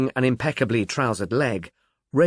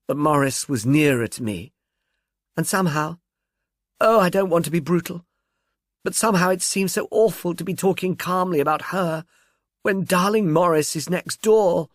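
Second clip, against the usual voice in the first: tearful plea; 5 semitones higher than usual. Acted. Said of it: "she pleaded with a catch in her voice".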